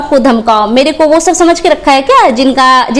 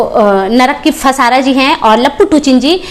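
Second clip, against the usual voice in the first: ordinary delivery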